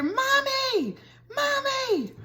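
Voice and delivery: put-on high, distant little-boy cry for mommy; pitched-way-up child wail from a distance; child calling out. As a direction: call for mom from far away like a small kid. Child calling from porta potty.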